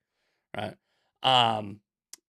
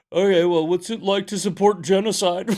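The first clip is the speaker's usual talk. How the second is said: higher, louder sneering caller voice; mocking caller; 5 semitones higher, 7 dB louder than usual. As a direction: jeer it like the prank caller. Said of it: "And they go".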